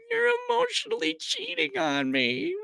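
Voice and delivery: very high, squeaky falsetto wail; mock upset wife. Wife catching husband with digital girlfriend, mock-hysterical falsetto. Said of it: "a wife catches her husband with a digital girlfriend".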